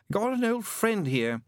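high, slow, careful answer; guardedly noncommittal. Acted. Demon hints that he has company, noncommittally. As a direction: draw it out, giving little away. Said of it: "The voice was horribly noncommittal".

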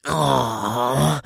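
mocking. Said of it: "the first man's voice mocked".

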